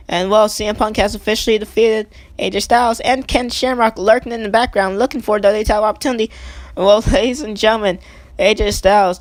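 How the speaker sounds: energetic wrestling announcer calling the action; play-by-play commentary. Acted. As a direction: call the match live like a ringside commentator.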